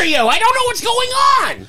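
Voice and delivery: high shrill panicked cartoon yell; cartoon sidekick voice, high and yelling; weird Toad impression. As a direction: squeal it like a frantic little mushroom. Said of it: "What kind of weird impression?".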